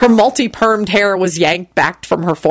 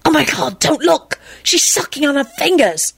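put-on high-pitched boy's disgusted horror; appalled schoolboy squirm; shuddering; 8 semitones higher than usual. A narrator's reading. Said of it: "he shuddered".

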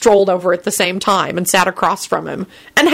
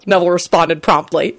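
usual speaking voice

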